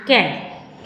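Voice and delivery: normal talking voice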